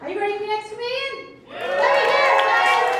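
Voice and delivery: loud, high, fast crowd call; hype shout. Acted. Host pumping the crowd for the next comedian, loud hype shout. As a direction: whip the room up.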